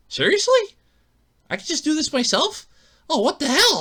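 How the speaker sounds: high, loud astonished questions; thrilled it can be done at home; amazed disbelief. Retold or imitated. Car owner learns he can fix it himself, loud surprised.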